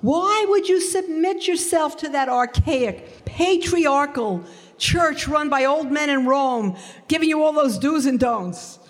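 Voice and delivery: high, indignant friend's voice; imitating her scolding. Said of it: "she said to me".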